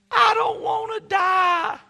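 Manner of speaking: high, slow, loud wail; imitated crying out. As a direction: mimic a terrified child's drawn-out scream. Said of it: "She was crying out".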